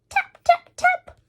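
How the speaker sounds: very high, tiny tapping sound; knock imitation. A narrator's reading. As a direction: tap it out high and light.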